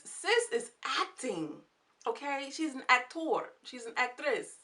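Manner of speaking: high, hammed-up Italian accent. Shown as a demonstration. Praising the writer's acting to viewers, mock Italian accent. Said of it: "this is my Italian accent".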